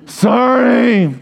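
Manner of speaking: high, drawn-out mock sorry; whiny put-on kid's apology; fake apology. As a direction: exaggerate the insincere sorry. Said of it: "Sometimes it's so fake".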